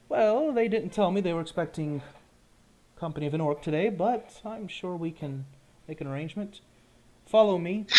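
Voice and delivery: a hint of a gate guard letting a visitor in. Guard at the keep gate answers a visiting orc, lightly voiced guard.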